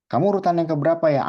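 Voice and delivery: high, quick, bright classroom question; asking teacher voice. Acted. Teacher quizzing pupils in role-play, high quick question.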